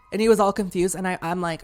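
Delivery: usual speaking voice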